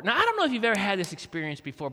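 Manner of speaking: usual speaking voice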